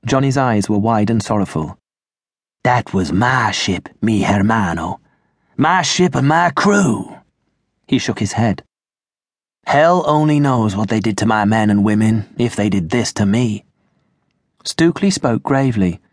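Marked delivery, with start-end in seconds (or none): 2.6-7.2 mournful pirate captain. Acted. Johnny voiced by narrator. 9.7-13.6 mournful pirate captain. Acted. Johnny voiced by narrator.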